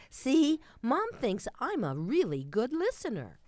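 put-on high, bright kid's boast; a smug child crowing to her brother; little girl voice. A lightly voiced little girl telling her brother, smug and high.

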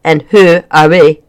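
loud Scottish-style demand; gruff, suspicious man with a brogue; thick accent. A narrator's reading. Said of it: "his accent was thick and tricky to understand".

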